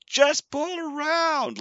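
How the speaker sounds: high, slow, drawn-out yell from far off; distant yell. Retold or imitated. Worker yelling from the window to the car, drawn-out call. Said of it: "he's yelling out the window to us".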